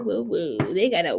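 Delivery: ordinary delivery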